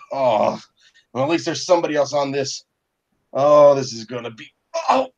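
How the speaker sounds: hammed-up groaning complaint; pained marshal. Wounded marshal talking to Russet.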